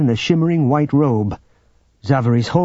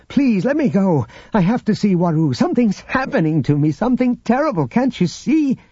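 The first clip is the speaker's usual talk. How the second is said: high, urgent pleading; desperate Luke; 10 semitones higher than usual. A narrator's reading. Figure voice of Luke.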